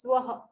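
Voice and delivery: "Swaha" as two syllables, swa-ha; correct Swaha. Shown as a demonstration. Teacher correcting, "Swaha" said as two syllables. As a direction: join the sounds into "Swaha". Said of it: "It is not "Suvaha," it is".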